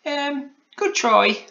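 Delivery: very high, slow praise; hesitant, kindly little-man encouragement; Alan Taylor, generous. A narrator's reading. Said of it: "said Alan Taylor generously".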